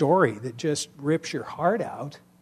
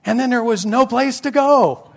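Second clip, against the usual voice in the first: retold critique; 8 semitones higher than usual. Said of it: "but I said".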